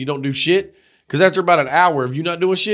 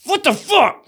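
high, slow drill-sergeant bark; military mode; 10 semitones higher, slower than usual. Retold or imitated. Mock drill sergeant yelling at a lazy coworker. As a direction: bark it like a drill instructor on a slacker. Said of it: "I'll be going military mode".

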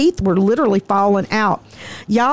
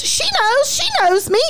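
high mock voice. Defendant points speaker out in court.

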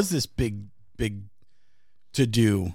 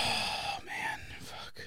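pained breathless groan; wincing through the cramp; stomach cramps; 14 dB quieter, much slower than usual. Real, not acted. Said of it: "I'm cramping so bad".

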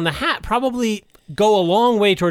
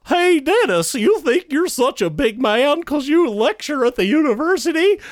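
clown voice; 11 semitones higher than usual. Retold or imitated. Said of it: "I'd be like".

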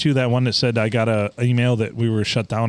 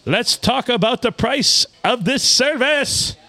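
higher, slow mock Russian scammer; mock Russian accent; 10 semitones higher than usual. Host reading the spammer's line in a lightly mock Russian accent. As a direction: play the pushy foreign spammer. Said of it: "terrible Russian accent".

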